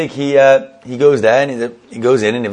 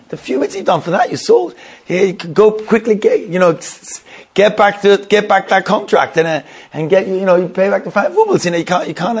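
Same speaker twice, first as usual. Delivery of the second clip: higher-pitched, agitated retelling of a wife's demands; urgent wifely scolding; 6 semitones higher than usual. Retold or imitated. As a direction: press him urgently, as an exasperated wife. Wife scolds, urges husband back.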